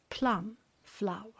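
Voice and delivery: ordinary talk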